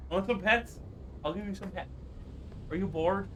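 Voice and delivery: soft, tender pet talk; cooing to dog. Real, not acted.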